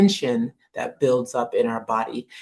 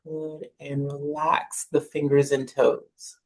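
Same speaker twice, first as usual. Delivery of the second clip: calm, unhurried guide with long pauses between phrases; guided meditation. Acted. Jedi teacher guides students lying on the floor through breathing, calm meditation voice. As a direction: ease them through each breath, lifting gently on the in-breath.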